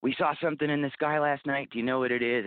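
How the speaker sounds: high, eager tourist asking about lights; excited visitor. Retold or imitated. Bookstore owner imitating curious visitors, high and eager. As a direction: mimic a wide-eyed visitor asking. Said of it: "they say".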